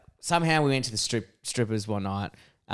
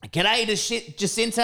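cocky greeting; 8 semitones higher than usual. Retold or imitated. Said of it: "turns to this girl and is like".

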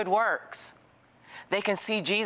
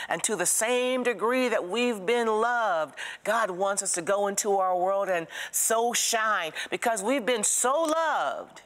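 preaching at full force; 4 semitones higher than usual. Acted. Preacher urges congregation to go out, full force. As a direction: rouse the church to go out and shine.

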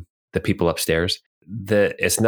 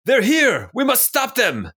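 alarmed bartender. Acted. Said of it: "he points at you guys and he says".

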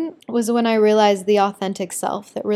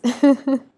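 short giggle; 4 semitones higher, 4 dB louder than usual. Real, not acted. Laugh after naughty things.

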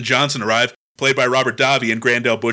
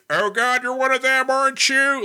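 Alan Rickman; 10 semitones higher than usual. Retold or imitated. British actor posing as a hostage, mimicked in high voice. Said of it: "going to sound like this".

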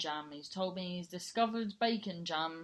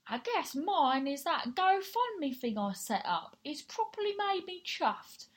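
lightly put-on Geordie, pitched higher; a hint of a Geordie accent, higher up; Geordie impression; 5 semitones higher than usual. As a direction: read a friend's message in a rough Geordie voice. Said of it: "So I go do this voice".

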